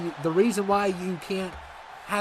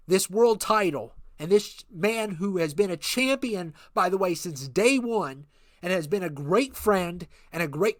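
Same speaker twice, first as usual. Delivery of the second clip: wrestling manager promo; 3 semitones higher than usual. Acted. Manager Ken Chrysler hyping the champion to the arena, showman promo. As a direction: hype the crowd like a ring manager.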